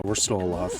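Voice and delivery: usual speaking voice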